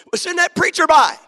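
loud, high, pleading cry to God; a father crying out to God to send the preacher; desperate shouted prayer. Retold or imitated. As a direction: beg heaven at full voice.

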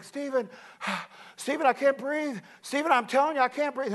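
mock pleading with a sighing gasp; gasping wife. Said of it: "Mary Snyder, she's like".

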